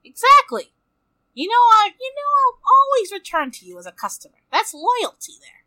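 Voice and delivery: high, loud and fast eager pitch; eager salesman. Acted. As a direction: oversell your loyalty brightly. Player hams up eager customer.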